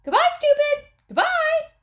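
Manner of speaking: very high, loud squawk; bird calling out. A narrator's reading. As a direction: call it out like a shrieking mynah. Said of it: "Uncle Feather called out".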